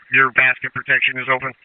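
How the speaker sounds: ordinary delivery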